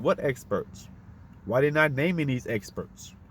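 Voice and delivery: usual speaking voice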